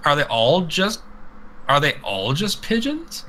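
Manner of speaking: a touch of hammed-up confusion; mock confused. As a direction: wonder aloud, utterly baffled. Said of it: "I'm like".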